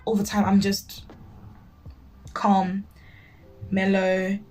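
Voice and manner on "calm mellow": ordinary delivery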